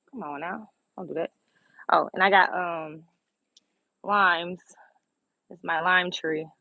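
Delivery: usual speaking voice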